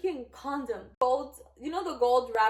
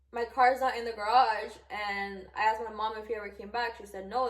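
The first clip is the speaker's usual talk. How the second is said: ordinary talk, nothing marked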